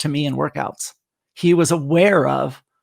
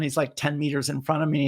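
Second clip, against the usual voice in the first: ordinary delivery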